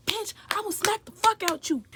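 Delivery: high, loud furious snap; retold angry threat. Threatening the roommate who started the fight. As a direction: threaten her like you mean it.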